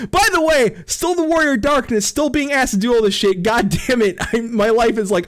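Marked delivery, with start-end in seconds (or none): none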